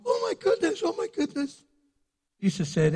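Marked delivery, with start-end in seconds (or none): 0.1-1.6 high, fretful oh my goodness; mock a nervous fluster; flustered panic. Jesus pretending to fret over offended Pharisees, high flustered panic. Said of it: "Jesus didn't go".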